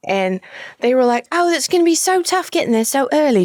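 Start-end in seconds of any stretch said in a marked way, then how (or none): 1.3-3.4 higher-pitched imitation British patient; British accent. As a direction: put on a British "darling" voice. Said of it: "I had a British patient".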